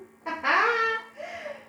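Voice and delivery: a high, loud burst of laughter. Real, not acted. Mocking the man's looks, she bursts out laughing. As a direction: laugh at how unappealing he was.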